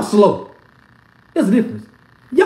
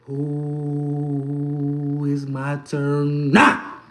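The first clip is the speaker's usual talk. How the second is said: drawn-out low taunt, dragging every word; slow mocking taunt; 7 semitones lower, 5 dB quieter, much slower than usual. Coach taunting rival fans, slow drawn-out gloat.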